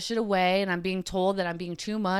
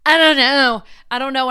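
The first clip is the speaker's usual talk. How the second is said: overwhelmed; 6 semitones higher, 9 dB louder than usual. Real, not acted. Overwhelmed, voice jumps up.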